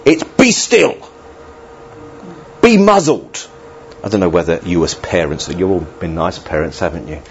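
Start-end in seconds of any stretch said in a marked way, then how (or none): 0.2-3.5 a higher, stern command to the storm; stern rebuke. Acted. Preacher voicing Jesus rebuking the storm, stern. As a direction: order the storm to be still. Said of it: "it was quite a stern, it was a rebuke".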